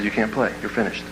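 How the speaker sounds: a father's soft blunt verdict; quiet, grave dad. Retold or imitated. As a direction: let him down gently but finally. Dad delivering bad news.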